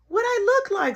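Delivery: put-on high, protesting kid voice; mock kid complaint; whiny kid. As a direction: whine like a kid. Little brother voiced.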